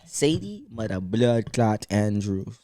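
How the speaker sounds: put-on slow drawn-out Jamaican accent intro. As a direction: announce her name in a Jamaican accent. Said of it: "I throw in the Jamaican accent for you".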